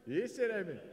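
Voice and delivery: delighted loud repeat to the crowd. Real, not acted. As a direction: call it out again, pleased.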